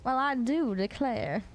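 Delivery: slow Southern belle drawl; Southern accent, slow and ladylike. Acted. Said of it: "Is that, like, Southern Belle voice?".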